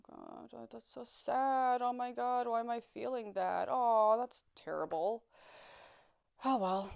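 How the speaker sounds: slow, emotionless sadness, lightly acted; numb, detached. Shown as a demonstration. Showing how the pills numbed her sadness, detached and slow. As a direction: let the words go by without feeling. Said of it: "it would be like being sad and like".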